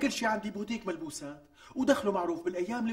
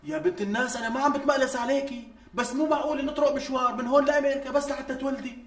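heated objection; 5 semitones higher, 5 dB louder than usual. Acted. Husband argues back heatedly.

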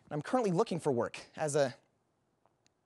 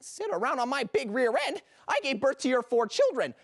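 higher-pitched, affronted comeback; indignant tirade; 6 semitones higher than usual. Acted. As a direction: fire back, outraged, listing every grievance. Indignant wife answers husband's insult.

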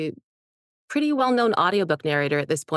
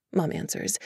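ordinary talk

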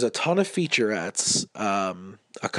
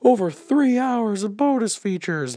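mock ad voice; 12 semitones higher, 5 dB louder than usual. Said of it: "it's like".

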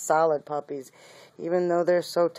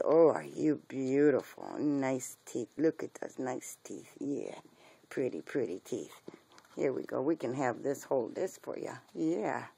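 lower, much softer pet talk; soft cooing; 4 semitones lower, 8 dB quieter than usual. Real, not acted. Admiring a puppy's teeth, soft and low cooing. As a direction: murmur close to the puppy.

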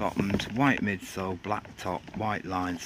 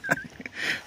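high squeaky laugh. Real, not acted. Squeaky laugh after joke.

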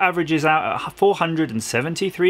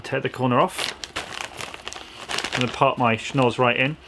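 ordinary talk, nothing marked

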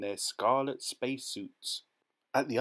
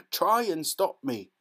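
defiant; 6 semitones higher, 6 dB louder than usual. A narrator's reading. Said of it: "retort to Mr Wonka".

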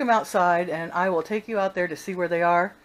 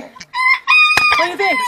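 high slow squeal; 6 dB louder, much slower than usual. Acted. Selfie squeal.

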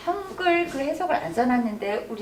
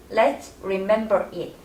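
slow model read; 2 semitones lower, much slower than usual. Shown as a demonstration.

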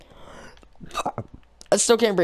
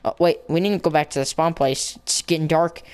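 normal talking voice

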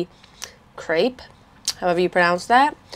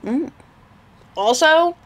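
talking between bites; chewing while talking; 8 semitones higher than usual. Real, not acted. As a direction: mouth partly full. Seen 1: Bites at -5 to -3; closed working mouth, sandwich in hand during words.

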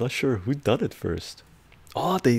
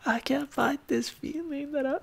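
high pitch. Shown as a demonstration. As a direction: sing it in a high falsetto, much higher. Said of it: "the high pitch".

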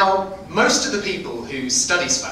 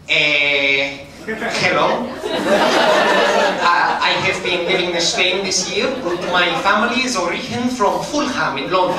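English with a put-on Spanish accent; hammed-up Spanish accent on English; fake Spanish accent. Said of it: "speaking like".

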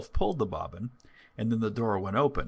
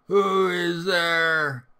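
loud, very drawn-out call in a raised voice; wolf's gruff call; 8 semitones higher, 7 dB louder, much slower than usual. A narrator's reading. Wolf in grandmother's bed calling to the door, loud gruff attempt. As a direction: call out slowly and loudly as the wolf. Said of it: "hearing the gruff voice of the wolf".

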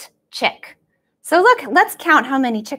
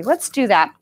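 usual speaking voice

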